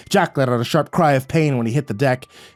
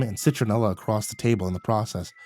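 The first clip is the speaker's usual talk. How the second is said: plain reading voice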